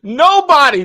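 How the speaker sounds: high, loud disbelieving cry; exclaimed disbelief. Real, not acted. Host echoing her cohost, loudly incredulous. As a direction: shout it in disbelief.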